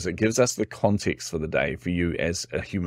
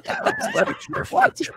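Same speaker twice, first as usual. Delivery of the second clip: squeaky, high laughing "what"; real laughter; slower than usual. Real, not acted. Laughing at the misunderstanding.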